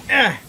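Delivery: high sharp cry of fright; startled scream. Real, not acted. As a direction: yelp as the hit lands. Rapidash attacks again.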